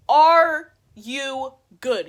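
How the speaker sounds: very loud, hugely drawn-out words; acted-out word-by-word fury; outraged challenge. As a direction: bellow each word separately, very slowly. Repeated, louder furious retort.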